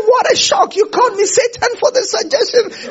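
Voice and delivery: high, half-laughing disbelief; mock shock. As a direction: act wounded and amazed. Peter shocked at being called Satan.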